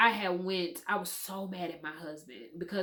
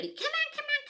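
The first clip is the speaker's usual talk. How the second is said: much higher, excited hurry-up; eager husband. Retold or imitated. Excited husband hurrying the nervous mother, squeaky and high. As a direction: mimic a giddy husband rushing her. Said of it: "my husband said".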